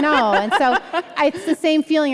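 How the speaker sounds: laughing along as she answers. Real, not acted. Author laughs at the host's church remark, laughing while talking.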